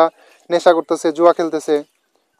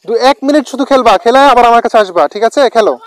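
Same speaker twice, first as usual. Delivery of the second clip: higher, louder call across a field; yelling across the room; 4 semitones higher, 4 dB louder than usual. Real, not acted. Shouting instructions to boys on field.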